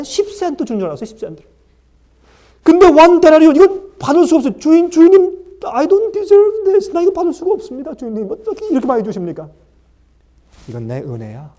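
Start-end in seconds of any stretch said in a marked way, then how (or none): 2.6-9.5 high, pleading cry of gratitude; overwhelmed worker. Acted. Preacher voicing a grateful late worker to the master, high and overwhelmed. 10.7-11.5 master's reply. Acted. Master answers worker.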